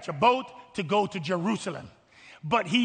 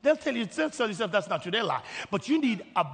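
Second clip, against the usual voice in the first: ordinary delivery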